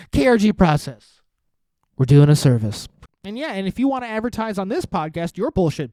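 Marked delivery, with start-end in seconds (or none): 0.1-1.2 punchy, louder slogan read. Shown as a demonstration. Host plugging his own business, loud ad voice. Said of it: "That's the tagline". 2.0-2.9 lower, much louder slogan read; deep loud announcer. Shown as a demonstration. Host plugging his business, booming ad voice. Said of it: "That's the tagline".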